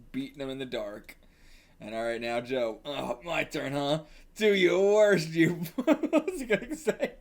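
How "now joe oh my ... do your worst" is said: ordinary talk, nothing marked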